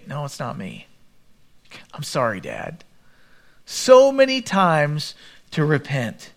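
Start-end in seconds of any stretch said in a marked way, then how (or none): 0.1-2.9 humble confession. Pretend. Imagined humble confession to father.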